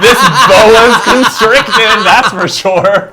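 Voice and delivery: slow, laughing through the words; giggling delivery. Real, not acted. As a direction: drag it out while laughing.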